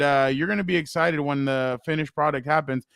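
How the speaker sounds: ordinary talk, nothing marked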